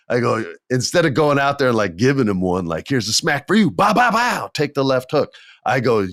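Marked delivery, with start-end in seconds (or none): 2.9-5.3 mock punches.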